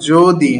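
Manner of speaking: যদি with a closed, rounded "o"; correct closed-o pronunciation. Shown as a demonstration. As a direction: say যদি as "jo-dee". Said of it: "যদি নয়".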